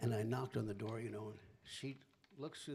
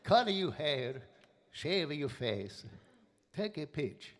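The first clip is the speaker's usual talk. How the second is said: Italian grandmother; 3 semitones higher, 6 dB louder, slower than usual. Retold or imitated. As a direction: slowly beg him like an old nonna. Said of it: "She goes".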